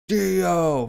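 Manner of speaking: sharp high call; shouted name. Acted. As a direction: call out his name hard. Shouting villain's name again.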